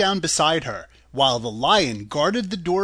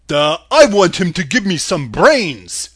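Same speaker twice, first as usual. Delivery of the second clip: slow, loud, dopey eager voice; eager Scarecrow; 9 dB louder than usual. A narrator's reading. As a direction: drag it out like a simple straw man. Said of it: "said the Scarecrow eagerly".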